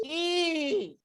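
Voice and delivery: high, thinner "I" without much force; wrong way. Shown as a demonstration. Teacher showing pupils the wrong "I", high and weak. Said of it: "Bukan gitu".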